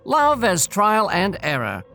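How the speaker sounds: plain reading voice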